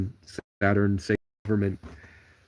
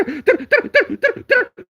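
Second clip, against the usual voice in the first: very high, loud chattering bird noise; shrill flock chatter, half laughing; imitating a swarm of birds; 7 dB louder, slower than usual. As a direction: squeal like a flock of birds diving in. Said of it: "a bunch of birds swarm over here and go".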